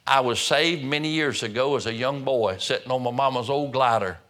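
ordinary talk

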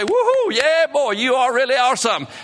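high, loud whooping congratulations; mock cheering. Preacher imagining God applauding him, mock cheering. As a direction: whoop it up in pretend admiration.

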